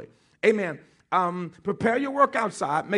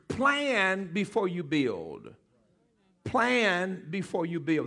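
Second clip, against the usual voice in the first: slow, lower, stretched-out words; slow dictation; 3 semitones lower, 3 dB quieter, much slower than usual. Real, not acted. Preacher dictating a note to the congregation, slow and deliberate. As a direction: give it out slowly for note-takers. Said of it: "write these words".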